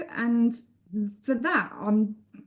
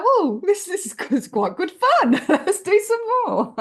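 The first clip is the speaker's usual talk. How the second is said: laughing thought; 8 semitones higher, 5 dB louder than usual. Retold or imitated. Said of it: "I thought to myself".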